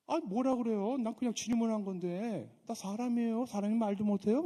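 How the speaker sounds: put-on high, loud protesting young man; exasperated David. David answering his scolding brother, loud high protest. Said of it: "이런 얘기를 하는 거예요".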